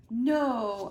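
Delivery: loud gasping no; shocked. A narrator's reading. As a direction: blurt it out in disbelief. Kid reacts to theft news.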